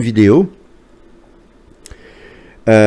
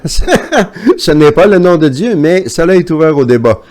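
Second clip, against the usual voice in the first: higher, louder, slowed with a laugh; laughing correction; 5 semitones higher, 3 dB louder than usual. Real, not acted. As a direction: chuckle while denying it is God's name. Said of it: "oui, effectivement".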